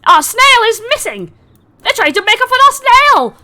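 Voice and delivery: very high, loud panicked squeal; alarmed Harengon cry. Acted. Rabbit-folk shouting that the snail is stolen, high panic. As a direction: shriek it like a frantic rabbit. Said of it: "he bounds forward and he goes".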